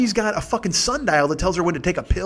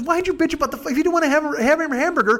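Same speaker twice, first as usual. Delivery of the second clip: higher, put-upon questioning; mock complaint; 10 semitones higher, faster than usual. Girlfriend challenging him about the show, mock complaint. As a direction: voice her complaint higher, as a retold protest.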